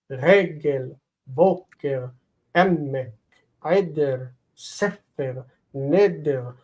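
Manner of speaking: Regel, boker, emek, eder, sefer, neder, each stressed on its first syllable. Shown as a demonstration. Teacher listing example nouns, Regel to neder each stressed on the first syllable. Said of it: "They're stressed on the first syllable".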